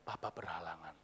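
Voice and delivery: very low, soft fatherly request; solemn father. Acted. Father asking his child for one thing, solemn and hushed. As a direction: ask quietly and low as an old father.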